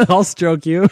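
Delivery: words breaking into loud laughter; real laughter through the words; laughing. Real, not acted. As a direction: crack up while talking. Cracking up at the innuendo.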